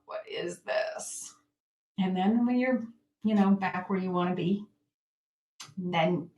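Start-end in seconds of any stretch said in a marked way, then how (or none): 0.1-1.3 whisper. Acted. Quietly examining own trigger.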